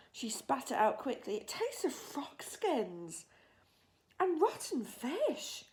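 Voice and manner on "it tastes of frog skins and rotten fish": put-on slow, higher girl's voice in disgust; a child describing a foul taste. A narrator's reading. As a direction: Sophie complaining to the giant. Sophie disgusted.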